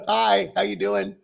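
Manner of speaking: hi through real laughter; laughing greeting. Real, not acted. Host greeting a viewer online, laughing hello.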